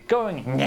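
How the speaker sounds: a weird goblin cackle; goblin cackle demo. Shown as a demonstration. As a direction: cackle like a silly little goblin. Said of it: "outside of anything that your paladin character would ever do".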